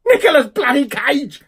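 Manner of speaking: loud, high-pitched exasperated yelling; outraged shouting. Real, not acted. Horrified at the film he drew.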